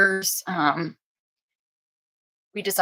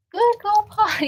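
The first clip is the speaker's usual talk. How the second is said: squeaky sing-song praise for the puppies, much higher; high puppy praise voice; 13 semitones higher, 3 dB louder than usual. Real, not acted. Praising puppies after potty.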